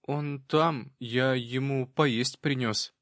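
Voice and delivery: put-on slow, hesitant little boy; shy boy. A narrator's reading. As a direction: explain haltingly like a child. Boy explains shyly to man.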